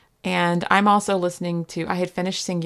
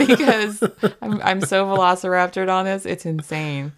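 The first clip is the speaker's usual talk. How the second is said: ordinary talk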